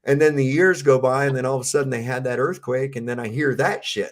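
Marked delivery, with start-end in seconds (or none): none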